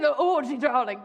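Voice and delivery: a hint of a grand society lady; lightly redoing the grand hostess on request; grand old lady. Retelling for a friend who asked, lightly grand. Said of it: "Can you do it?".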